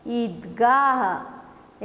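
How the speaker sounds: very slow, Idgah stretched out syllable by syllable; teacher drawing out Idgah; slowly blended word. Shown as a demonstration. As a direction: model the word slowly for the class. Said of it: "अभी ये चार वर्ण वाला शब्द".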